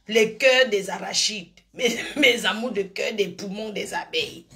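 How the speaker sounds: giggling through the words; laughing. Real, not acted. Sweet-talking her viewers, laughing through it.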